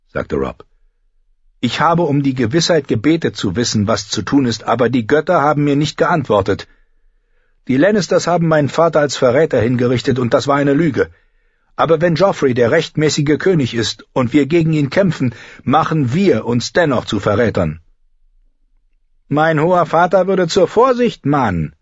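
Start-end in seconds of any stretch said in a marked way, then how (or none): 1.6-17.8 higher-pitched, lightly voiced lord weighing his conscience; troubled young lord. Robb confesses his doubt. 19.3-21.7 put-on high-pitched voice for an old courtier; old knight, cautious. A narrator's reading. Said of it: "sagte der alte Sir Stavron".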